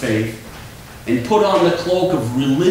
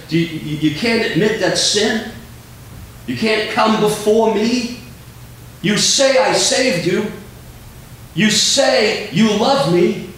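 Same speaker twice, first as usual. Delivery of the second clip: higher-pitched, pointed questioning; challenging God; 6 semitones higher than usual. Acted. Preacher voices God confronting a believer who won't admit sin, challenging. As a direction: confront them as God, phrase by phrase.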